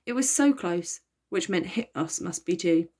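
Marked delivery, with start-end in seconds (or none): none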